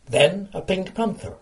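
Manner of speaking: normal talking voice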